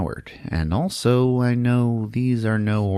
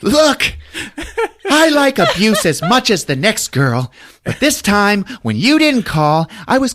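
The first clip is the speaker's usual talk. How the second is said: much higher, louder falsetto-ish delivery; mock woman's voice; 5 dB louder than usual. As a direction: play her as a scorned lover. Diane's high voiced reply.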